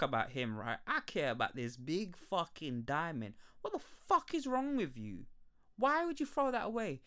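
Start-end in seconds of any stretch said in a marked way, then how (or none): 3.6-7.0 high, indignant outburst; exasperated shouting at a movie character; angry rant. Real, not acted. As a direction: rail at her in disbelief. Ranting at Rose about diamond.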